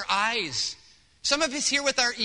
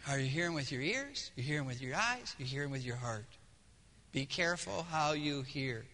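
much softer and lower, searching; quiet questioning; 9 semitones lower, 11 dB quieter than usual. Real, not acted. Pastor quietly asks the congregation how they hear, soft. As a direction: lean in and ask gently.

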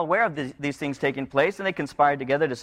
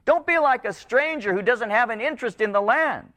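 insistent, higher-pitched pleading; crying out to God; 7 semitones higher than usual. Acted. Israel appeals to its covenant God, crying out. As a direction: preach it as a people's urgent appeal. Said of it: "they're crying out, and they're saying".

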